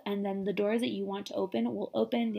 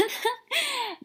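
high breathless laugh; 3 dB louder than usual. Real, not acted.

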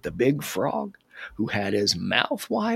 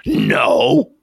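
booming, blasted refusal; loud shout; 2 semitones lower, 11 dB louder than usual. Acted. Big frog answering little frog's question, loud shout. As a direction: shout it out at full force.

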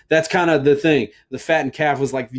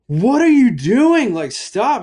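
high, loud alarmed outburst; alarmed dad; 12 semitones higher, 6 dB louder, slower than usual. Retold or imitated. Dad seeing crayons on the kitchen table, alarmed. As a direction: catch her mid-crime in alarm. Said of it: "And I was like".

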